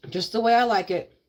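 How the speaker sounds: talking while chewing; words round a bite of food; mouth full. Real, not acted. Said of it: "Talking with my mouth full".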